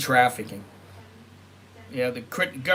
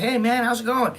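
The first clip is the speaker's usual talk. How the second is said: high, loud cheery hello; friendly stranger's greeting; 9 semitones higher, 5 dB louder than usual. Retold or imitated. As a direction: greet him like an old buddy. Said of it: "this guy just".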